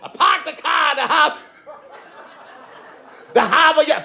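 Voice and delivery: high-pitched, hammed-up Boston accent. Shown as a demonstration. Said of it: "All right, let me try it again".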